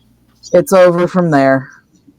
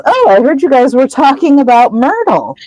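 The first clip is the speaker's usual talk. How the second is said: teasing Peeves voice; 7 semitones higher than usual. Retold or imitated.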